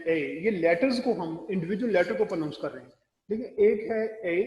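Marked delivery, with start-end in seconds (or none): 3.3-4.4 ए said slower and higher; stress on ए. Shown as a demonstration. Teacher stressing ए for the class, slower and higher. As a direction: lean slowly on ए. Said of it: "ये one की sense में use होता है".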